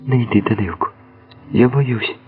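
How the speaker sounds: high, fearful plea; frightened pleading. A narrator's reading. Woman begging her beloved not to go out, frightened.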